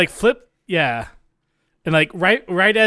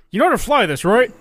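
a light put-on Scottish accent, a touch higher; Sean Connery Scottish accent; 4 semitones higher, faster than usual. Co-host doing his best Sean Connery, mild Scottish accent. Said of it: "my best Scottish accent".

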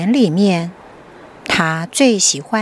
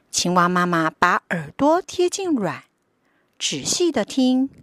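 put-on lively storybook narration for kids; reading to a toddler. A narrator's reading. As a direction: read it like a bedtime picture book. Picture book read to children.

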